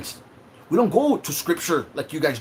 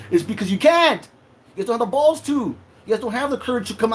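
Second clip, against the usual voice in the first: angry outburst; 6 semitones higher, 5 dB louder than usual. Real, not acted. Ranting at critics.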